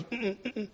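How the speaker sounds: mock wife's hum of disapproval; disapproving hum. Imitating wife's quiet disapproving hum. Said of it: "She goes like that".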